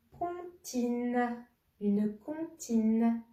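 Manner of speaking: slow reveal, each syllable held long; drawn-out syllables. Shown as a demonstration. Teacher revealing the second charade's answer, drawn-out syllables. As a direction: stretch the word out in two long syllables.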